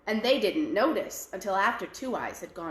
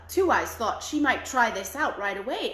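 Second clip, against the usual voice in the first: ordinary delivery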